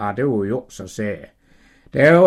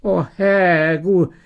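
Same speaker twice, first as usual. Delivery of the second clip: high, giggly apology; Sara pleading; 8 semitones higher, 3 dB louder than usual. Acted. Sara confessing to the Lord she laughed, high and flustered. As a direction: beg forgiveness half laughing.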